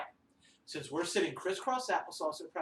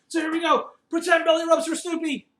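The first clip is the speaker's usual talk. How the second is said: higher and louder, bubbly; excited invitation; 9 semitones higher, 9 dB louder than usual. Real, not acted. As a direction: get them excited to pet Snoopy. Inviting kids to pet puppet.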